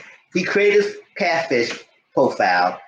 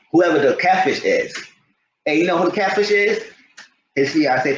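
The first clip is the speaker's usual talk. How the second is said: ordinary talk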